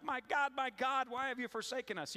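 anguished cry. Retold or imitated. Voicing despair in a crisis.

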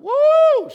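high, loud, slow woo cry; fan's woo. Retold or imitated. Preacher imitating the fan's touchdown cheer, a huge high woo. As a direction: let out a huge stadium woo. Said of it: "She did her woo".

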